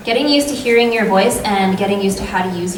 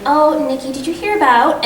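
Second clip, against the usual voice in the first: much higher, bright friendly greeting; talking to best friend; 7 semitones higher, 4 dB louder than usual. Shown as a demonstration. Voice actor demos chatting to bestie Nikki, friendly and high. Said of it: "and you're like".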